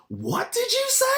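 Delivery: high, sharp confrontational demand; menacing challenge. Retold or imitated. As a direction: square up to the boy. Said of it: "I took off my helmet and I goes".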